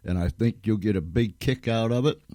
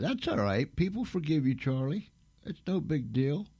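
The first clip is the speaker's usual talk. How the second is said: high, soft coaxing lilt; light singsong comforting with rising ends; soothing forgiveness; 6 semitones higher, 4 dB quieter than usual. Real, not acted. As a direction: console a helper in a lifted, gentle voice. Soothing Charlie after his mistake.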